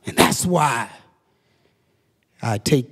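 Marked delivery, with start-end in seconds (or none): none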